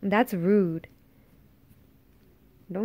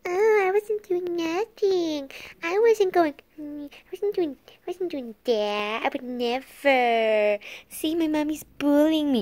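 mocking baby voice; 6 semitones higher than usual. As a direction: play the innocent little kid. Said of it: "And now you wanna act all".

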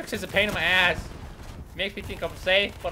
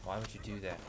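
barely voiced, muttered much softer; hushed complaint; 10 semitones lower, 14 dB quieter than usual. Real, not acted. Player muttering at the game, hushed and soft. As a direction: asks it under his breath, almost to himself.

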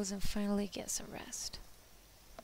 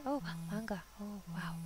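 ordinary talk

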